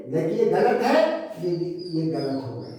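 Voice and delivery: ordinary delivery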